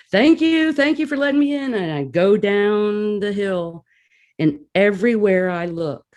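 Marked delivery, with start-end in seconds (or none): 0.1-1.7 much higher, giddy thank-you; excited thanks. Retold or imitated. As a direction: gush your thanks to the gatekeeper, high and bright. Thanking the gatekeeper, awestruck.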